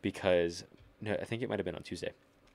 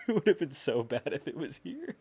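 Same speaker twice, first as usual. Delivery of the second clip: laughing through it, higher; 8 semitones higher, 5 dB louder than usual. Real, not acted. Laughing with his friend about it. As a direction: giggle the line out. Laughing after whispered aside.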